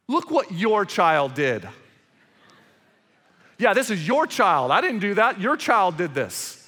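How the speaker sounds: loud, accusing parent, slow on the first line; mock blaming spouse. Parent blaming the other parent over a misbehaving child, mock accusation. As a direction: point the finger at your spouse.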